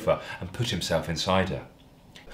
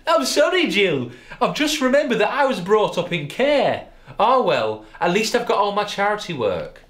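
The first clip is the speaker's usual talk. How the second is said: higher, louder mock-wounded hunk; hammed-up Darren voice; 12 semitones higher, 5 dB louder than usual. A narrator's reading. Darren confessing his sad past to Jill, hammed-up and higher. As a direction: overplay the sensitive tragic man.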